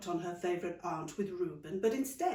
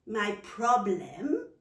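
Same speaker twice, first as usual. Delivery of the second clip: slow puzzled echo; 5 dB louder, much slower than usual. Acted. Child echoing question, slow.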